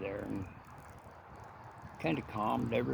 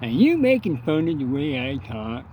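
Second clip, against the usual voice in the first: angry cleft-lip imitation; 3 semitones higher, 9 dB louder than usual. Old Luke confronting Henry at the coffee bar, loud mock cleft-lip voice. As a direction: bark it like an offended old man with a cleft lip. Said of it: "old Luke".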